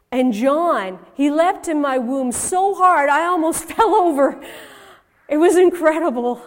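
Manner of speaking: joyful excitement. Acted. Mother recalls John leaping in her womb, joyful excitement. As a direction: exclaim it with a laugh breaking in.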